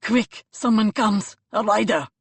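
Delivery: loud alarmed call; a loud growling alarm; urgent warning. Acted. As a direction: warn the others, urgently. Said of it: "the third man's warning growl".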